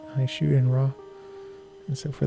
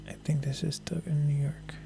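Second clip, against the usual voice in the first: whispering; 7 dB quieter than usual. Real, not acted. Talking low while filming a bridge.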